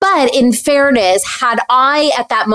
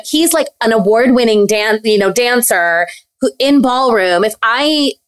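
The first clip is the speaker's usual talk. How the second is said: ordinary talk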